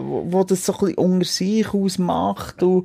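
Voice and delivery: usual speaking voice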